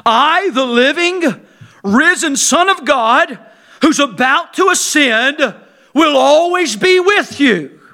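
slow, high, loud and emphatic. Acted. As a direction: lean on every word of the promise. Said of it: "It's very emphatic".

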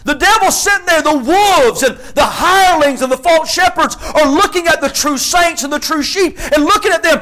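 high, loud, ringing preacher's cry; preaching at full force. Acted. Preacher to his congregation, shouting the psalm with fire. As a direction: lift the psalm to the rafters like a revival preacher.

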